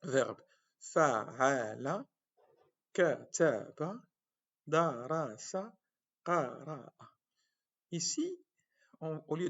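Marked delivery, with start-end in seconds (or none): 0.8-6.9 "kataba" and "darasa" said slowly, syllable by syllable; verb models. Shown as a demonstration. Teacher pronouncing example verbs slowly. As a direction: model each verb carefully. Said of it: "pour créer plusieurs verbes".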